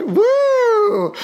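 high whooping cheer; woo. Real, not acted. Host whooping to celebrate Pride with viewers. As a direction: shriek it out with joy.

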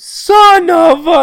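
very high, loud, drawn-out mock fury; furious outburst. Teacher blowing up after Aaron's correction, high slow yell. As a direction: explode as the teacher, stretching each word.

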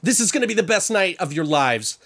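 high, bubbly excitement over the wedding; thrilled planner greeting the couple; chirpy fake-sweet enthusiasm; gushing coordinator.

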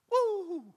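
sudden high, loud "woo" of alarm; a comic whoop as if backing away; playful startled whoop. Real, not acted. Reacting to someone's bad mood.

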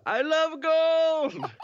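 very high, slow, drawn-out mock Goldmember voice; hammed-up Goldmember; Goldmember impression. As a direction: croon it like a camp villain. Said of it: "It's Goldmember".

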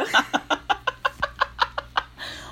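real helpless giggles, very high; wheezy high laughter; high breathless laughing fit. Real, not acted. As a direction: crack up until out of breath. Cracks up at the fan fiction.

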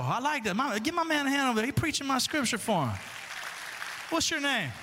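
loud, high, teasing praise for the man quoting; playful hype. Real, not acted. Preacher cheering a congregant who quoted ahead, loud and playful. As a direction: hype up the congregant, laughing along.

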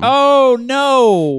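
high loud groan of dismay; dismayed wail. Real, not acted. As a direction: wail oh no in protest. Dreads a long movie.

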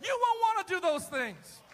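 loud, rising preacher's cry; preaching shout. Real, not acted. Preacher shouting to the church about the first commandment. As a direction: drive the point home full force.

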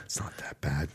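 soft unvoiced whisper, barely there. Real, not acted. Comforting a friend who hates Christmas, whispered. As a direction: lean in and whisper it gently to a grumpy friend.

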